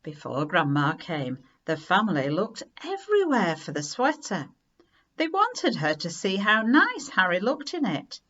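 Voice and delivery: ordinary delivery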